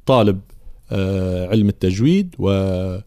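normal talking voice

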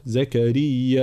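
زكريا slowly, hamza stopped hard at the end; زكريا with a held-breath stop at the end; glottal stop shown. Shown as a demonstration. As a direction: cut the voice off on the final hamza of زكريا. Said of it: "مع حبس الصوت".

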